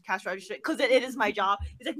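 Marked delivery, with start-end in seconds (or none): none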